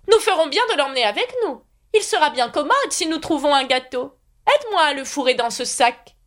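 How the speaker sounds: put-on high, fussy old knight voice; high knightly voice promising to escort Alice. A narrator's reading. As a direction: play the kindly, dotty knight up high. Knight rambles.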